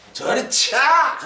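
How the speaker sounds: slow Gyeongsang dialect retort, 저리 차 drawn out; the king brushing off priests in Gyeongsang dialect; Gyeongsang snap. Retold or imitated. King waving off the priests, in a Gyeongsang-dialect snap. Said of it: "경상도 말로".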